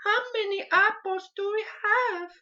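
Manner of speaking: put-on a child's high Mexican accent, puzzling over apples; young Mexican kid accent. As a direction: squeak it out like a small Mexican child asking for help.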